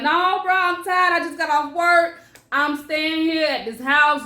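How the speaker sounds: loud, high, stubborn pushback; defiant refusal. Retold or imitated. Telling her fiance she won't leave, loud and defiant. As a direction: dig in and refuse him loudly.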